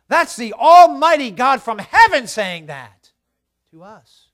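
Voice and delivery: loud, slow, hammered pulpit delivery; full-force preaching. Real, not acted. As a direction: thunder it to the congregation, stretching key words.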